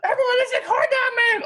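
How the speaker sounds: high laughing shout of alarm; freaking out. Retold or imitated. Coworkers freaking out over the corn dog man, high laughing shout. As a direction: squeal it out like staff spotting a wanted man. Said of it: "they started freaking out".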